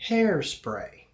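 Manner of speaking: Hairspray with HAIR stretched high and loud; slow, pondered "Hairspray"; slow word. Shown as a demonstration. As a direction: draw out HAIR, then drop on spray. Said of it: "slowly and think about the word".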